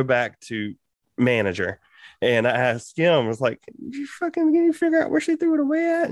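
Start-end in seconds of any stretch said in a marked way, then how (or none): 3.8-6.1 very high, whining plea; imitated desperate pleading. Worker asking manager where it was thrown, imitated high pleading. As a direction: beg in a squeaked-up voice. Begs manager again for help.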